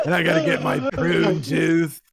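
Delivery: loud hammed-up pensioner grumble; exaggerated crotchety old-lady voice; cranky old lady. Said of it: "She goes".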